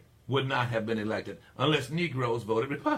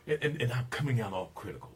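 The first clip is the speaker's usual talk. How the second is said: usual speaking voice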